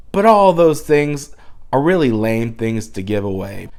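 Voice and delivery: normal talking voice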